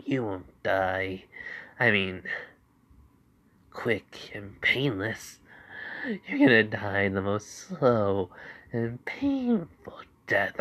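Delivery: slow, breathy menacing threat; sinister whisper. Acted. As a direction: whisper the death promise slowly. Izuku threatening the villains.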